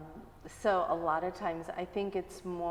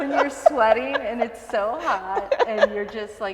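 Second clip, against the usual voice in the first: higher, louder, slow words through laughter; laughing while recalling the heat; 5 semitones higher, 11 dB louder than usual. Real, not acted. Mom recalling the hot yoga class, laughing.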